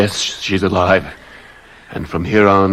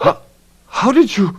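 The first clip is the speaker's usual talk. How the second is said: shocked. Acted. Shocked at the husband's arrival.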